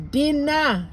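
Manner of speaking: "dinner" with the final ER said as a clear open A, dinna; dinner as dinna, final R dropped; Jamaican patois dinner. Shown as a demonstration. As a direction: end "dinner" on an A sound, no R. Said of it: "we replace that E R at the end and it becomes".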